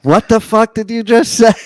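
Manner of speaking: loud, high, outraged disbelief; indignant outburst. Retold or imitated. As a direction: snap it back in shock. Shocked retort to a date.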